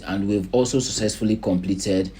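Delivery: ordinary talk, nothing marked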